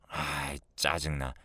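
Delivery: low, soft grumble; annoyed aside, dropped in pitch and volume; irritated muttering. Acted. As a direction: grumble under your breath.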